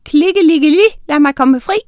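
high, loud rhyming incantation; shouted spell. A narrator's reading. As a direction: call out the magic rhyme. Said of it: "Og så råbte hun".

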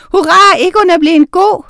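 loud, high hurrah; exultant shout of celebration; jubilant cheer. A narrator's reading. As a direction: cheer for joy. Captives cheer from the table.

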